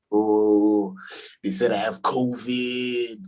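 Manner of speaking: slow, put-out complaint; offended voice. Retold or imitated. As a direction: sound slighted and wronged. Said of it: "it's easy to be like".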